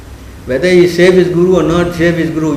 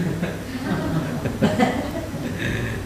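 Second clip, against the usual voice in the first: soft laugh; 12 dB quieter than usual. Real, not acted. Laughs at own habit.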